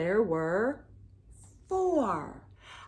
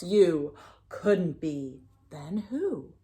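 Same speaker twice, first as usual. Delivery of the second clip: plain reading voice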